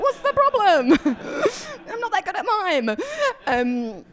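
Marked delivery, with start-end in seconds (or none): 0.0-3.0 much higher, laughing confused reply; baffled laughing. Retold or imitated. Confused locals reply to her, higher and laughing. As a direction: squeak it out through a laugh. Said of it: "They're like".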